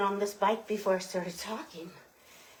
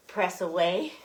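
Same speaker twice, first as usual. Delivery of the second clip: normal talking voice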